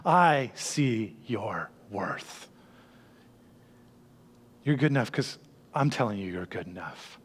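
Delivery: soft, slow and low reassurance; slow down and speak it gently, like a comforting father; hushed, tender reassurance; tender God voice. Acted. God's voice after the worried voice.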